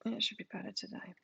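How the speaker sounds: normal talking voice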